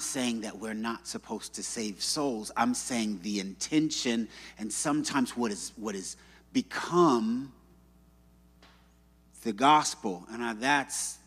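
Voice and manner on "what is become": ordinary talk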